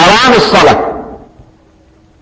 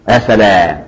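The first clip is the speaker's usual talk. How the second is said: normal talking voice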